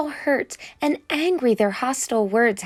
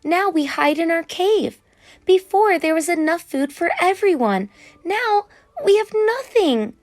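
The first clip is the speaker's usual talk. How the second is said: rallying Rainbow Fish; 8 semitones higher than usual. A narrator's reading. Rainbow Fish rallies his friends.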